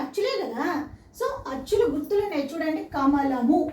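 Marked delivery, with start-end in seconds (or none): 2.9-3.6 "కమలము" with the final mu fully sounded; "కమలము" ending on a clear u vowel; Telugu full ending. Shown as a demonstration. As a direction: pronounce "కమలము" syllable by syllable to the last u. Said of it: "చివరి అక్షరాలకి మాత్ర ఉంటుంది".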